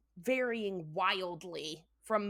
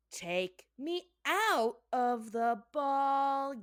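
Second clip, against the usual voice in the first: very slow stretched title; drawn out; much slower than usual. Acted. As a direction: stretch every word.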